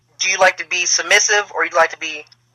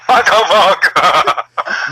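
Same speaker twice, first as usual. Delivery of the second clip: laughing disbelief; 3 dB louder, much slower than usual. Real, not acted. Shocked by answer.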